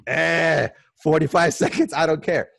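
high, loud dismissive blurt; hammed-up don't-care attitude, laughing midway; mock careless student. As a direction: brush it off with comic defiance. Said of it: "And here comes this guy".

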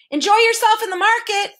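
high, loud parting call; shouting back. A narrator's reading. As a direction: call it back over the shoulder. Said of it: "shouted back this undersecretary".

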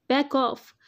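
ordinary delivery, nothing marked

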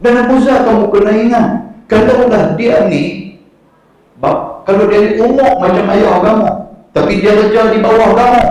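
impassioned sermon rebuke; fiery preaching. Acted. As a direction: preach it up high, rebuking with fervour. Preacher voices the Prophet's rebuke.

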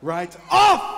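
sudden shout. Acted. Performer hits the crowd with the story's violent punchline, sudden shout. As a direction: burst into a loud shout.

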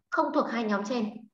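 ordinary talk

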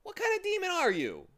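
very high, loud protest; affronted, high-voiced accusation; indignant. A narrator's reading. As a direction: end on an outraged high pitch. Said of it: "said Milo indignantly".

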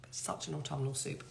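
soft dreamy whisper. Real, not acted. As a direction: whisper it like a treat. Savouring soup, repeated softly.

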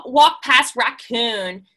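ordinary delivery, nothing marked

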